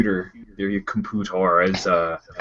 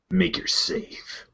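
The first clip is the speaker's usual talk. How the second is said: hushed boss; 8 semitones higher, 6 dB quieter, much slower than usual. Acted. GM voicing minotaur boss.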